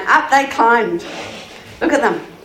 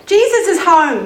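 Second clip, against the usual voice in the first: high, loud excited announcement; yelling; 7 semitones higher, 6 dB louder than usual. A narrator's reading. Friend yelling the news of Jesus' return, excited shout. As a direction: call out the news to everyone. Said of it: "yelled his friend".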